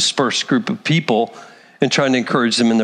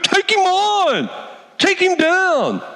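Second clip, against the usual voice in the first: much higher, slow, mocking cheerleader voice; mocking egging on; much slower than usual. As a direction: egg the fighter on like a fool. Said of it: "blithering idiot going".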